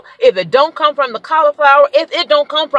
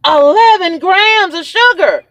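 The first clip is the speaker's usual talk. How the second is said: high, slow outraged repeat; freaking out; 8 semitones higher, 3 dB louder, slower than usual. Real, not acted. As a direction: freak out, stretching each word up high. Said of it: "and I'm freaking out".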